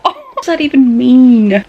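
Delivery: baffled outburst. Real, not acted.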